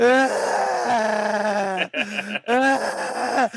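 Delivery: high, loud imitated wails; mock pained cries. Siblings wrestling with the tree, mock pained cries. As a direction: yelp like kids struggling with a tree.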